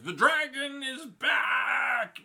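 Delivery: loud, high, slow proclamation; prophetic shout. Retold or imitated. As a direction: boom it out like a prophecy. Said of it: "basically she goes".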